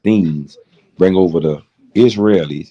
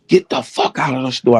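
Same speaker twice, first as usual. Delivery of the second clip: angry shopkeeper yell; 7 semitones higher than usual. Retold or imitated. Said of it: "the younger one".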